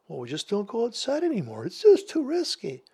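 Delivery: high, mocking fearful voice; mock timid homebody. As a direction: parody a scared shut-in. Mocking fearful stay-home people.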